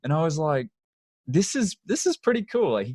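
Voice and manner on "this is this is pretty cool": much higher, amazed retold reaction; excited reaction. Recalling hearing the dog story at the garage, amazed. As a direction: react like it's the best story ever.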